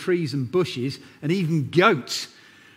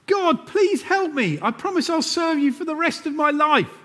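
a dangling man begging God; desperate plea; 14 semitones higher than usual. A narrator's reading. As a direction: plead for your life. Jack begs God.

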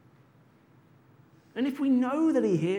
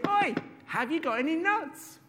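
higher, cheeky squirrel voice; 8 semitones higher than usual. Acted. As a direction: pester the teacher for nuts. Squirrel asks again.

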